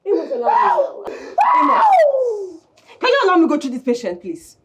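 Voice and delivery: high, fast, half laughing; distressed outburst. Acted. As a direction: burst out, stammering in distress. Woman resists, distressed outburst.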